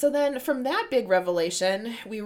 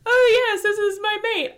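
high, loud mock-casual introduction; mock tea-party chitchat; 12 semitones higher, 8 dB louder than usual. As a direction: chirp it as if out to tea. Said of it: "like, they're fucking out to tea".

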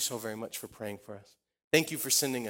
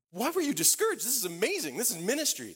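surprised; 11 semitones higher, 3 dB louder than usual. Retold or imitated. Surprised at his friend's discouragement.

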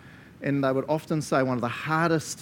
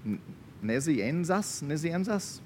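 slow, tentative stab at "Nazianzus", rising like a question; hesitant, questioning "Nazianzus"; unsure pronunciation; 2 semitones higher, 4 dB quieter, slower than usual. Real, not acted. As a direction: try "Nazianzus" twice as if asking. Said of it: "I can never say his last name".

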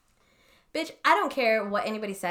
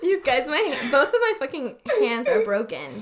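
louder, higher, words through laughter; helplessly amused, pushing through giggles; laughing protest; 4 semitones higher, 6 dB louder than usual. Real, not acted. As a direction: get it out while still cracking up.

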